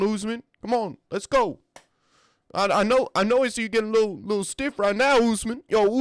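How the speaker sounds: high, loud hyped-up fighter calling out his opponent; Buckley impression. Fighter hyping up his opponent at the face-off, high and loud. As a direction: pump him up like a fired-up fighter at the face-off. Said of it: "How was my Buckley impression?".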